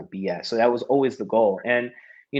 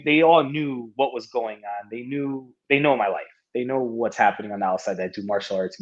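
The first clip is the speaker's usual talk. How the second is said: ordinary delivery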